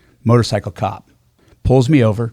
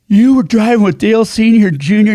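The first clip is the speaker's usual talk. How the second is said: high, loud burst of recognition; imitating a worked-up cop; excited cop; 8 semitones higher, 5 dB louder than usual. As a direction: blurt it out, thrilled and incredulous. Cop recognizes driver, stomps excitedly.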